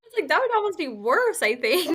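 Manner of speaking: high, laughing through it. Real, not acted. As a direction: laugh while speaking. Laughing at the story.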